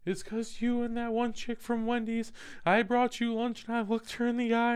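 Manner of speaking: high-pitched imitation of an upset girlfriend. Girlfriend blaming him on the drive, high mock upset voice. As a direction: put on her high, whiny complaint. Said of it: "She's like".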